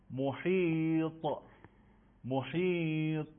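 محیط very slow, with a stretched ح and ط; careful recitation drill; slow model word. Shown as a demonstration. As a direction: draw محیط out slowly. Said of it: "کلمهٔ بعدی".

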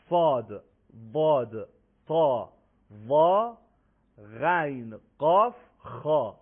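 loud, spaced, heavy letter names; heavy articulation. Shown as a demonstration. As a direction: punch out each Arabic letter thick and full.

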